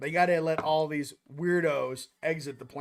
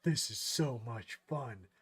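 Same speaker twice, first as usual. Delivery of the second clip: deadpan; 6 semitones lower, 7 dB quieter, slower than usual. Retold or imitated. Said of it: "sitting there in class like".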